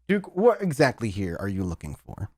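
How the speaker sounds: ordinary talk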